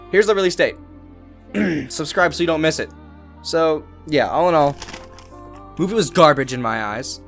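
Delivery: usual speaking voice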